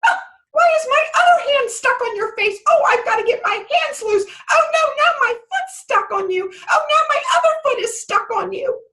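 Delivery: fly into a squeaky little rage; fairy temper tantrum. Acted. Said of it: "fairies can have quite a temper".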